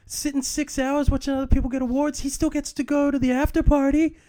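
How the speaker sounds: high, loud, animated comic rant; comedian impression. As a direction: hype it up like a stand-up bit. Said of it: "they were like".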